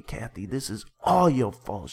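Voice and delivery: loud stress without a real scream; hammed-up, accusing yell; mild mock yell. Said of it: "Rufus starts yelling and screaming".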